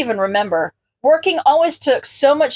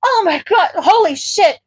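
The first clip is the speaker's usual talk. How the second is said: mortified exclamation; 7 semitones higher than usual. A narrator's reading. Izuku, embarrassed, exclaims to himself in shock. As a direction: panic at your own mistake. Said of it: "Izuku yells".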